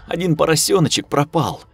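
tearful. A narrator's reading. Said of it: "плачущим голосом".

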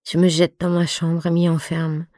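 ordinary delivery, nothing marked

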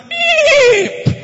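high squealing horse whinny; mock horse cry, very high and loud. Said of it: "Sama kuda".

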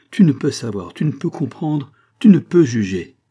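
loud, emphatic run of three; insistent plea. A narrator's reading. Mother stopping the child at the door, insistent. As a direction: hammer each clause, urging him to listen.

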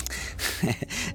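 quiet airy laugh under the breath; soft unvoiced chuckle; soft breathy chuckle. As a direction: let out a little amused breath-laugh. Laughs at the plumb line.

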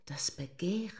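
a lady's seductive whisper.

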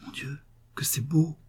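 breathless, awed whisper; hushed, choked whisper of wonder; oppressed whisper. A narrator's reading. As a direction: breathe it out, overwhelmed by beauty. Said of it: "une voix basse et comme oppressée murmura".

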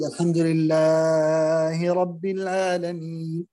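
الحمد لله رب العالمين with vowels stretched too long; overlong madd. Shown as a demonstration. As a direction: drag out the vowels of الحمد لله رب العالمين where they should be short. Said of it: "يمد في غير مواطن المد".

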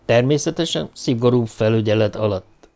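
ordinary delivery, nothing marked

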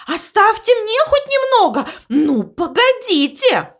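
high, panicked plea; horrified wail. A narrator's reading. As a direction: wail pleadingly not to be left out. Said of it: "в ужасе завопил Мопси".

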